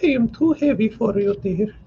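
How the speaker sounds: put-on high, tender young wife smiling; with a smile. A narrator's reading. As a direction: tease him gently with a smile. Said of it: "she said with a smile".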